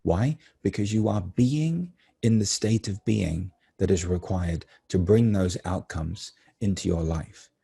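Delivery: ordinary talk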